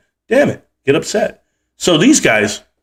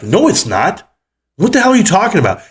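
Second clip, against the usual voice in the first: exasperated retort; 6 semitones higher than usual. Retold or imitated. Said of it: "And you're just like".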